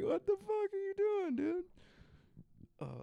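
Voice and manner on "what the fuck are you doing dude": very high, loud incredulous yell; mock outraged shout. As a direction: shout it in hammed-up disbelief. Acting out shocked onlookers' reaction.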